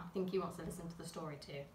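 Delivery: secretive storyteller whisper. Real, not acted. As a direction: whisper it so the bee won't hear. Aside to children about the bee.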